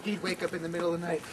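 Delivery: usual speaking voice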